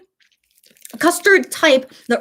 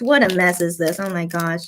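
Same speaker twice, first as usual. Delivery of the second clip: ordinary talk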